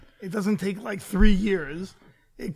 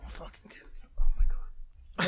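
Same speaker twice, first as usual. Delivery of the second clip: whispering; 2 semitones lower, 6 dB quieter, much slower than usual. Real, not acted. Muttered aside about young age.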